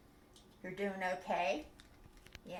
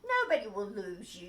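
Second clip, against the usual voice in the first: ordinary delivery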